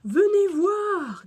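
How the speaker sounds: high, loud, drawn-out call; appel de Monsieur Fossile. A narrator's reading. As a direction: summon the children to look. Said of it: "dit soudain Monsieur Fossile". (appel sonore et traînant)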